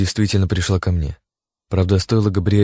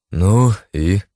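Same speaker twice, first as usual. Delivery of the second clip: detached; 4 dB louder than usual. A narrator's reading. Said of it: "отчуждённо спросил я".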